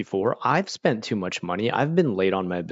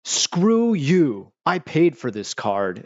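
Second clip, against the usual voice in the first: mock angry customer; 6 semitones higher, 3 dB louder, much slower than usual. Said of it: "They'd be like".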